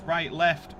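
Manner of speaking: ordinary delivery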